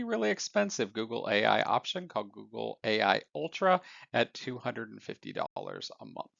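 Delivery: usual speaking voice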